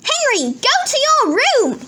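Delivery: high, loud scolding order; yelling mum. A narrator's reading. Mum sends Henry out, high loud yell. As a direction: yell it high like a cross mother. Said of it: "yelled Mum".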